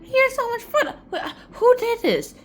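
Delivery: very high, loud squeal of wonder; gushing amazement. Acted. Boy seeing the garden first time, squealing amazement. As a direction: squeal it, overacting the delight.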